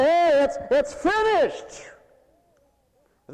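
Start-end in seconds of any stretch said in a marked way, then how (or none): 0.0-1.9 loud, high call, the last word drawn out slow; loud mock dismissal. Preacher to congregation, refusing the gossip: loud, drawn-out shout. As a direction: shout that it's finished, stretching it out.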